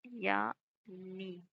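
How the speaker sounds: "या नी" split into two slow separate syllables. Shown as a demonstration. Teacher spells out the correct form for students syllable by syllable. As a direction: pull या and नी apart, very slowly. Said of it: "जिसका शुद्ध रूप होगा या".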